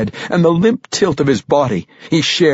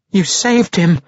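higher-pitched, mildly tearful cry; sobbing; 8 semitones higher than usual. A narrator's reading. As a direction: say it as if lightly sobbing, voice lifted. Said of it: "a sobbing Regis said".

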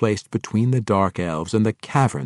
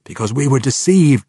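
sharp retort, higher and louder, in another voice; 6 semitones higher, 8 dB louder than usual. A narrator's reading. Drow messenger to orc warlord, retorting. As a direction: snap back the excuse. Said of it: "the drow retorted".

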